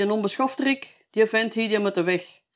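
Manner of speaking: a hint of an outraged official throwing him out; outraged director. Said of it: "Hij zei".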